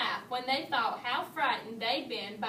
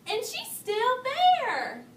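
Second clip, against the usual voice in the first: much higher, louder and slow; excitedly; 11 semitones higher, 7 dB louder, much slower than usual. A narrator's reading. Baby Bear finding Goldilocks, higher loud and slow. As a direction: draw it out thrilled. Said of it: "cried Baby Bear excitedly".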